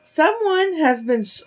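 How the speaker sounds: high-pitched character line; high, childlike quote; high storybook voice. A narrator's reading. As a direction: start high, as a little bear. False start before Papa Bear's voice.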